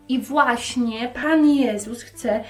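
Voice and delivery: usual speaking voice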